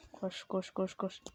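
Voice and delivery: very soft, low run chant; hushed, low self-urging; murmured urging. Real, not acted. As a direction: mutter it under your breath. Murmurs while running in game.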